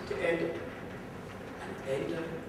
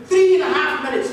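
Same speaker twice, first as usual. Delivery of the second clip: ordinary delivery, nothing marked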